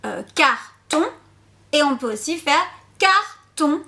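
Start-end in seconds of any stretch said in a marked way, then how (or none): 0.3-1.0 "carton" découpé en car, pause, ton; détache les deux syllabes de "carton"; syllabes tapées. Shown as a demonstration. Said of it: "on peut taper les syllabes". 3.0-3.8 "carton" en deux temps séparés, car puis ton; découpé en syllabes. Shown as a demonstration. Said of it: "on peut taper les syllabes".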